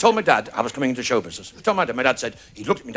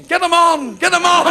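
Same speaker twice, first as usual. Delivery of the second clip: high, loud imitation of screaming fans; hammed-up screamed chant; mock screaming; 10 semitones higher, 5 dB louder than usual. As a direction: yell it like the ladies in the crowd. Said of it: "the ladies in the audience would be screaming".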